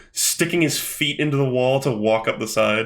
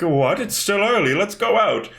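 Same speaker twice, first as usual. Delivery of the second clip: retold boyfriend; 6 semitones higher than usual. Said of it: "there's, like, this couple out and he's like".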